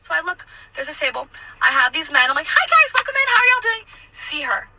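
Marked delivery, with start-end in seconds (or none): 2.5-3.8 very high, chirpy server greeting; sing-song customer-service welcome; perky greeting. Retold or imitated. Server welcoming a table of men, perky.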